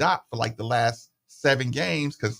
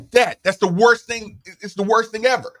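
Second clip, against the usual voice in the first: exaggerated complaint; 9 semitones higher, 7 dB louder than usual. Son complaining to dad.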